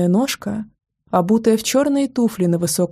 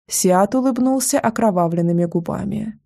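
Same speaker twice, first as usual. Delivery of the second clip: usual speaking voice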